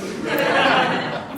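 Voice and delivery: high disbelieving burst of laughter. Real, not acted.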